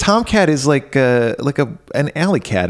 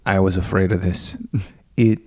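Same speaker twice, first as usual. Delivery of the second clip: low, softer, halting reveal; lightly voiced grave janitor; 6 semitones lower, 5 dB quieter than usual. Janitor revealing the werewolf, mock grave. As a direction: pause portentously, a hint of dread. [name]'s grave revelation, acted.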